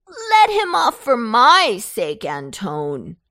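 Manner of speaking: loud, high whine falling away; whining. A narrator's reading. Pumpkin begs Antone to free his friend, whining. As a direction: whine for a favour. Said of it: "in a most whining voice".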